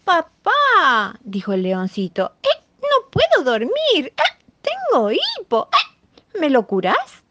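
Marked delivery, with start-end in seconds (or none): 0.0-1.2 high lion cub voice. Acted. 2.4-7.2 hiccupy cub voice. Acted. Lion cub with hiccups asking.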